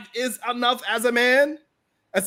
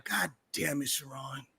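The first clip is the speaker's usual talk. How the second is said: soft, dreamy lovestruck woman, much lower and quieter; mock swooning woman; 12 semitones lower, 16 dB quieter than usual. Man imitating a smitten woman, soft and dreamy. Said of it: "And she's like".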